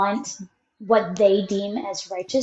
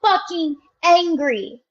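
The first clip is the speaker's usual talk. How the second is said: echoed angry demand; 8 semitones higher than usual. Retold or imitated. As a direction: join the rant, pitched up. Echoing the angry rant.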